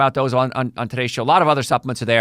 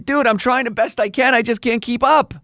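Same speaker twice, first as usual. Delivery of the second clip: much higher, pleading cartoon voice of a gut at its limit; overwhelmed digestive system; 10 semitones higher than usual. The digestive system begs its owner to stop, much higher pleading voice. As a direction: voice the struggling gut, pitched way up, begging for mercy. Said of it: "the digestive system is going".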